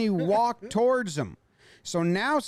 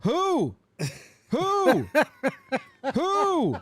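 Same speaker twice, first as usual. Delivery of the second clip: higher and higher owl hoots; owl hooting; 4 semitones higher, much slower than usual. Pretend. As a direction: hoot each who like an owl. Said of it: "gonna turn into an owl".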